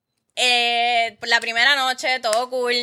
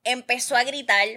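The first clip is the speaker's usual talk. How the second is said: ordinary delivery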